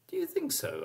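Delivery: high, soft questioning wife; wife's voice. Acted. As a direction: ask it timidly in a high voice. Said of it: "asked his wife".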